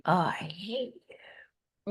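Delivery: slow, voiceless whisper of "I hate you"; retold child's hateful line. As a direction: hiss it out breathily, slowly, like a child's spite. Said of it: "with all of his might".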